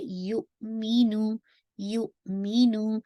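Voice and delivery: युमीनु sung slowly with a pure oo in यु; clear yu sound. Shown as a demonstration. Teacher shows the right vowel to students, slow chant. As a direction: stretch युमीनु with यु rounded, never यो. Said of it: "इसको आपने यो नहीं पढ़ना".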